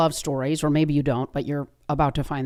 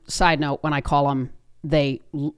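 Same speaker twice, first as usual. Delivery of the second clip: usual speaking voice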